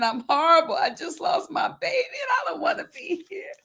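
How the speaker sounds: high, breathy held-back scream; screaming inside. Retold or imitated. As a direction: scream it with no voice at all. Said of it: "I felt like screaming".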